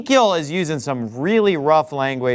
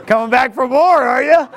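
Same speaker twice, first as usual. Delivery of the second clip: teasing, laughing, louder; laughing taunt; 6 semitones higher, 6 dB louder than usual. Retold or imitated. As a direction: laugh it at them as they return.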